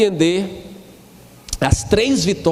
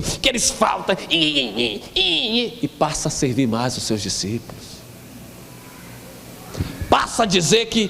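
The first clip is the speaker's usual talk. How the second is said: usual speaking voice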